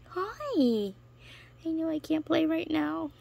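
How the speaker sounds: high cooing voice. Real, not acted. Telling her gecko she can't play, high cooing. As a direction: apologise sweetly to the pet.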